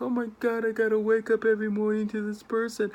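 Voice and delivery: groaning, put-upon complaint; exasperated dread. Retold or imitated. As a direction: whine it like a weary spouse. Dreading partner each morning.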